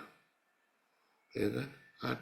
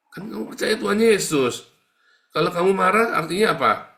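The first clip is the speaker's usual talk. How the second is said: much higher and louder, scolding the listeners; forceful rebuke; 9 semitones higher, 10 dB louder than usual. Real, not acted. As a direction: hammer the point home like a preacher. Preacher rebukes the congregation. (teguran keras berkhotbah)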